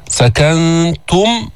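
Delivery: "سكنتم" with held nasal ن, then thin ت on u; rounded, light ta. Shown as a demonstration. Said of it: "ضمينا التاء المضمومة بدون تفخيم".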